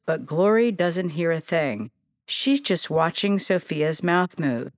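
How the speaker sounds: plain reading voice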